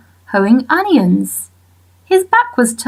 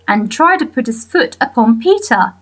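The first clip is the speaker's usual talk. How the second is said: ordinary delivery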